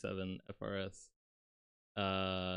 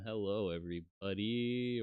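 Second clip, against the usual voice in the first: ordinary talk, nothing marked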